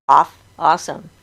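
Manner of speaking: ordinary talk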